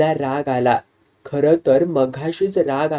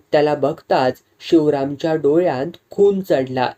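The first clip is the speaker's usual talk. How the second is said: usual speaking voice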